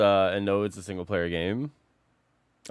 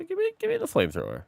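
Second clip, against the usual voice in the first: much higher, fast squeal; squeaky high voice; much faster than usual. Acted. Excited high squeal over new weapon.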